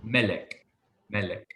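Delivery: melek stressed on mel; first-syllable stress. Shown as a demonstration. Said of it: "the accent is on the first part".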